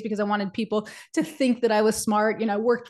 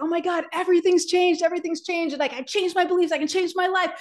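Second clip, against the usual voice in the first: much higher, bubbling with excitement; thrilled; 7 semitones higher than usual. Retold or imitated. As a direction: gush like you just changed your life. Thrilled after seminar.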